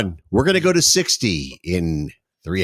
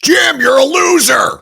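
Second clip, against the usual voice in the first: high, slow mock shout of a scolding mother; mocking mom; 8 dB louder, slower than usual. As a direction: hoog en traag, spottend geschreeuwd als een boze moeder. Imitating mom berating Jim.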